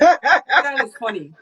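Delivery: slow laughing words, high and loud. Real, not acted. Answering a funny question, laughing out loud. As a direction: laugh through it, drawn out.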